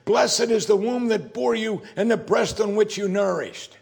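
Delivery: high, loud and gushing admirer; woman in the crowd crying out. Retold or imitated. Preacher voicing the woman praising Mary, high, loud, exclaiming. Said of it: "raised her voice and said".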